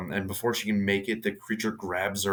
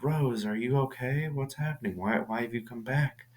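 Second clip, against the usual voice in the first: high, lilting mother voice; imitated mother; 5 semitones higher than usual. Monster posing as mom questions Rose, high lilting imitation. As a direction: play the false mother, high and singsong.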